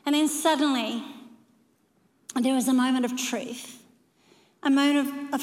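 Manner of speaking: ordinary talk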